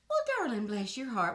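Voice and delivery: a syrupy Southern bless your heart; lightly voiced Southern lady; Southern sweetness. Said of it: "in the South".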